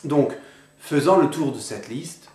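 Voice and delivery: ordinary talk, nothing marked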